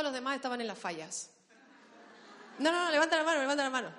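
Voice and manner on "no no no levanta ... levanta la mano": loud, raised-pitch protest; urgent insistence. Real, not acted. Preacher telling people to keep hands up, insistent. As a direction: call out over the room, insisting.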